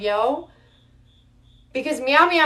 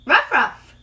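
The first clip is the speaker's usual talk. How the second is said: higher quick dog barks; 7 semitones higher than usual. Pretend. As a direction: bark it out fast and high. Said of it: "I gotta say it faster".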